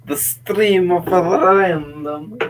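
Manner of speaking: stretched, exaggerated Finnish accent; mock Finnish accent. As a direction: imitate a Finnish accent, drawing out each word. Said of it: "The Finnish accent".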